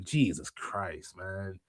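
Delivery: low, slow, hushed disbelief; weary sigh curse. Real, not acted. Fan groaning at the Bellas' return, hushed and slow. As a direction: drag it out under your breath.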